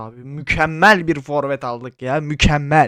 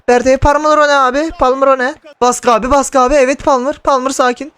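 tense shouting; 11 semitones higher, 8 dB louder than usual. Real, not acted.

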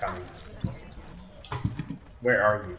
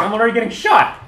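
normal talking voice

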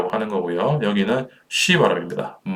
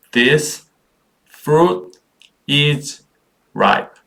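"This fruit is ripe" in isolated, raised words with pauses; 6 semitones higher, much slower than usual. Shown as a demonstration. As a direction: lift and separate each word of "This fruit is ripe". Teacher models word by word. (한 단어씩 또박또박 끊어 읽기)

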